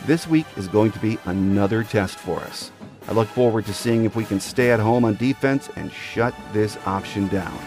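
ordinary talk, nothing marked

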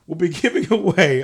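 ordinary delivery

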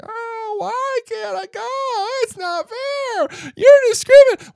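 mock a sniveling child denied a trip; whiny child. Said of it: "And little Timmy's like".

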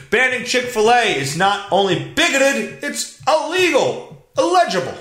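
high-pitched, hammed-up indignation; mock outrage. Mock outrage over headline.

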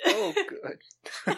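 sarcastic. Real, not acted. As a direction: fake cheer at bad food. Handed the dubious cereal.

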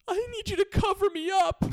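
put-on very high, loud kid shriek; imitated demanding daughter. Daughter having won her cup makes another demand, loud whine. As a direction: wail the demand like a small child. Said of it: "and then she says".

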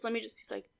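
ordinary talk, nothing marked

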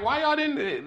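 high-pitched incredulous police question; mock questioning.

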